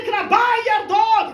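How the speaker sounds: high, loud request; eager buyer's shout. Acted. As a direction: call out eagerly from the crowd. Excited crowd wants dog.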